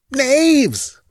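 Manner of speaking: high, wild scream of outrage. A narrator's reading. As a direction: scream it out far above your own pitch. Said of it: "screamed Rinaldo".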